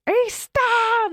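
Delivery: high-pitched imitation of a screaming daughter; mock scream, high but not very loud; retold scream. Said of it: "I could hear my daughter screaming".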